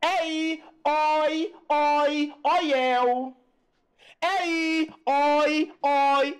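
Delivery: "Ei" and "oi" held high and level; showing the sounds. Shown as a demonstration. Teacher drilling open diphthongs, "Ei" and "oi" sustained. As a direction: chant "Ei", "oi" long with open vowels. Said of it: "ó o som".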